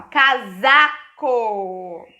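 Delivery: "casaco" very slowly, stretched syllable by syllable, S as Z; S sounded as Z. Shown as a demonstration. Teacher models reading for pupils, slow loud syllables and Z sound in "casaco". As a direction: draw out "casaco" loudly with a buzzed Z. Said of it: "utilizando as mesmas regrinhas".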